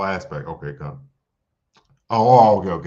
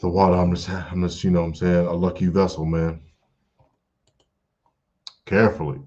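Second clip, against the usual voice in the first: ordinary talk, nothing marked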